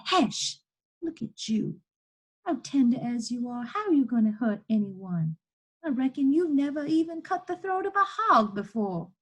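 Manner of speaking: lightly voiced, scolding Southern woman; scolding enslaved woman. Chained woman doubting the boy could hurt anyone, scolding.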